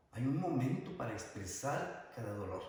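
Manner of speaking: ordinary talk, nothing marked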